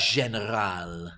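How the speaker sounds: drawn-out French-style pronunciation; mock French. Host showing off a fancy food word, mock French. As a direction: say it with a put-on French flourish. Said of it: "None of this".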